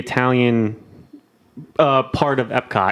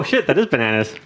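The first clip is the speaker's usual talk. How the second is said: usual speaking voice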